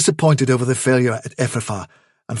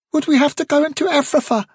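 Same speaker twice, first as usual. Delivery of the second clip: small, high, nervous question; young rabbit fearful of danger; timid; faster than usual. Acted. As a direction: ask in a frightened little voice. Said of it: "asked Pipkin timidly".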